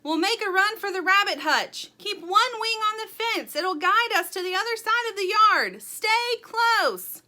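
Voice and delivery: high, loud urgent commands; rally the group over a storm; shouted orders. A narrator's reading.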